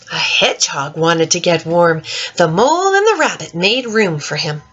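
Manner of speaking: put-on gentle, lilting read-aloud for children; story reading. Teacher reading The Mitten to children, lilting storytime voice. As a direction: read it like storytime to little ones. Reads picture book aloud.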